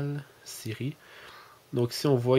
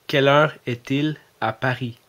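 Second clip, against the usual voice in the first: louder, careful European French accent for Siri; put-on European French accent; 3 semitones higher, 7 dB louder than usual. Shown as a demonstration. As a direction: switch to a France French accent so the phone understands. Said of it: "j'ai corrigé mon ton de voix, mon accent". (accent français de France, articulé)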